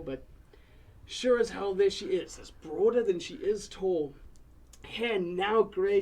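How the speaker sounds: ordinary talk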